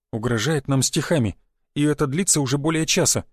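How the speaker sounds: usual speaking voice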